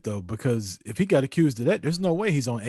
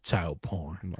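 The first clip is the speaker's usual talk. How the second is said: whisper; 6 semitones lower, 10 dB quieter than usual. Acted. Deadpan punchline whisper.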